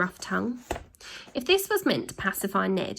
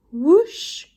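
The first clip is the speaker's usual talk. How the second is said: high, loud whoosh; whoosh sound; 9 semitones higher, 8 dB louder than usual. A narrator's reading. Narrator voices the van flying, high and loud.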